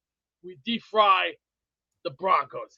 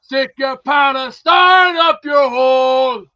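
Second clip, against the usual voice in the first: imitated yelling; 10 dB louder, much slower than usual. Mocking what Londoners yell at him, a slow loud mock shout. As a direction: bellow it like a jeering crowd. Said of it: "the other people yelling out".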